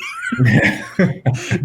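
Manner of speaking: big high laugh. Real, not acted. Laughing at own story.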